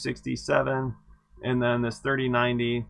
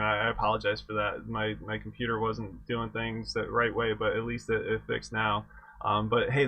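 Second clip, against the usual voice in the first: ordinary talk, nothing marked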